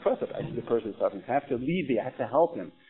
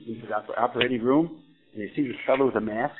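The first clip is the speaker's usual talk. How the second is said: ordinary talk, nothing marked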